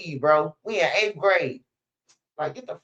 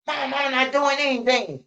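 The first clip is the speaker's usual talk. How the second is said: put-on whiny kid; 8 semitones higher than usual. Kid voiced in a story.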